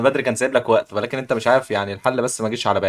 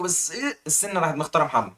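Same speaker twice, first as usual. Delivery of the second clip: ordinary delivery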